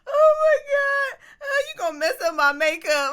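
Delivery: high, loud laughing cry; laughing so hard she tears up. Real, not acted. Host laughing about her makeup, crying with laughter. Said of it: "I'm crying".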